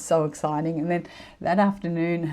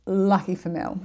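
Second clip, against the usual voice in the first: ordinary talk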